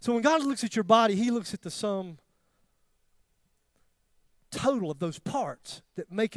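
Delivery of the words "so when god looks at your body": ordinary talk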